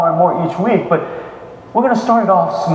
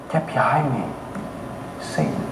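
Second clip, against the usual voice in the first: a hushed, grave "Get behind me, Satan"; quiet rebuke; 5 semitones lower, 10 dB quieter than usual. Retold or imitated. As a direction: drop the voice low and soft for the rebuke. Jesus rebuking Peter, quoted.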